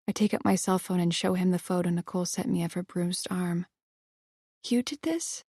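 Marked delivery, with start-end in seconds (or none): 4.6-5.4 high, soft, stunned; stunned question. Acted. Heroine realising Evan bruised Nicole, stunned. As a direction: ask it in disbelief.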